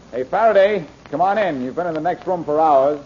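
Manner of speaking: high, loud call through the wall. Acted. Blackie calling Faraday in from the next room, loud and high. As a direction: call him in from next door.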